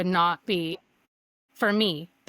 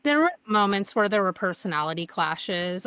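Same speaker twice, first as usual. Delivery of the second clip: usual speaking voice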